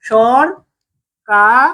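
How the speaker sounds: সর-কা- broken into drawn-out syllables. Shown as a demonstration. Teacher sounding out a misspelled word syllable by syllable. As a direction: spell সর-কা- out slowly, syllable by syllable. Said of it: "কি লেখে?".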